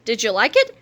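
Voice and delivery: usual speaking voice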